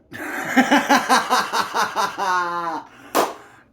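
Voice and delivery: big high cackling laugh; real loud laughter. Real, not acted. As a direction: burst out laughing. Laughs after the demands.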